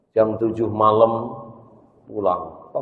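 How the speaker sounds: ordinary talk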